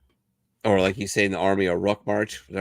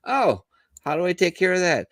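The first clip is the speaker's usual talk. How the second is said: naive soldier; 10 semitones higher than usual. Retold or imitated. Soldier asks medic naively.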